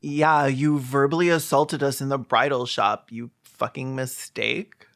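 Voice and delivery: ordinary talk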